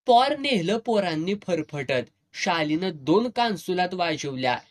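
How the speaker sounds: ordinary delivery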